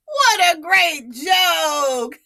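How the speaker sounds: slow, gleeful line into a high giggle; laughing boy. Acted. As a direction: crow over the joke and laugh.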